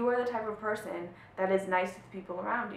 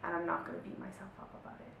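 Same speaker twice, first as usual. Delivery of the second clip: softer, kind reply to yourself; gentle self-talk; 9 dB quieter than usual. Retold or imitated. Teacher modelling loving self-talk, soft and gentle. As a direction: soothe the inner voice like a friend. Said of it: "And say".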